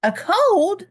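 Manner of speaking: high, loud startled question; hammed-up shock; surprised. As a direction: gasp it out in disbelief. Gerald surprised by the diagnosis.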